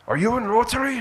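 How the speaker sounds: high, loud imitated Lithuanian accent; thick Lithuanian accent. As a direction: mimic an eager Lithuanian minister's thick accent. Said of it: "in this thick Lithuanian accent".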